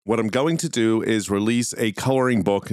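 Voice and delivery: ordinary talk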